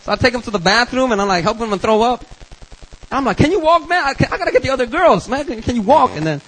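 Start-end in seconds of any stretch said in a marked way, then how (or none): 3.4-6.1 urgent higher-pitched plea; quick, raised-pitch urging. Acted. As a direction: press a drunk friend for an answer. Acted urgent question to drunk friend.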